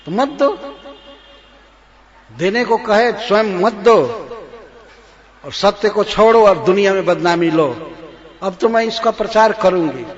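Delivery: threatening queen. Acted. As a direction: shout the threat of scandal. Kaikeyi threatening. (धमकी देती ऊँची आवाज़)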